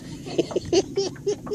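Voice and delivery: real high, wheezy giggle; gleeful giggle. Real, not acted. Fisherman laughing as fish bites, real high giggle.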